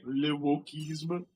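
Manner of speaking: put-on slow, drawn-out French accent on "Le wokisme"; mock French. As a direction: savor "Le wokisme" like a snobby Frenchman. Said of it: "get on camera and say".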